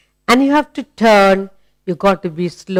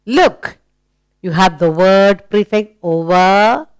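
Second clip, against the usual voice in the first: ordinary delivery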